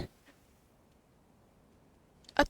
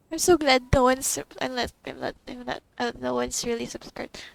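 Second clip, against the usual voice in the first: ordinary talk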